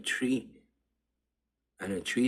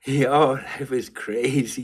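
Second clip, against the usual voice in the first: slow, louder, laughing through it; 3 semitones higher, 8 dB louder, slower than usual. Real, not acted. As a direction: marvel at it, still laughing. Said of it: "like, laughing".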